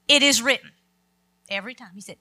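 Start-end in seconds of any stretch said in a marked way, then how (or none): 0.1-0.7 loud and firm, a bold proclamation; voicing Jesus rebuking the devil, forceful; firm declaration. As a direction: declare it with authority.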